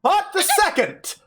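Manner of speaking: very high, grand proclamation; theatrical stage announcer. Acted. Unseen host announces the players' entrance, high theatrical proclaiming.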